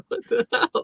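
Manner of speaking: very high laughing exclamation; amused disbelief. Retold or imitated. Retold laughing reaction.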